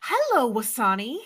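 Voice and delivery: high, careful "Hello, Wasani"; a small child greeting the baby brother perfectly; little kid's formal hello; mock polite toddler. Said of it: "you were like".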